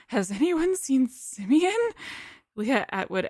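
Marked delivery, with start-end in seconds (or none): 0.1-1.8 high, slow and trembling. Acted. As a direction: quaver it out, pleading. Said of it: "asks in a trembling voice".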